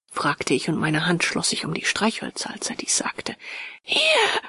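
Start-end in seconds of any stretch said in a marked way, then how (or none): 3.8-4.4 very high, piercing cry; sudden high-pitched scream of one word; shrill shriek. A narrator's reading. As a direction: burst out in a shrill, terrified falsetto. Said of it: "schrie er schrill auf".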